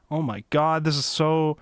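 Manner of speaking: ordinary talk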